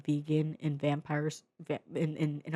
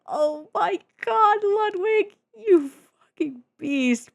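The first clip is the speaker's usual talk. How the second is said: very high, slow squealing praise; awed squeal; 6 dB louder, slower than usual. Real, not acted. Fan praising the guitarist, slow high squeal.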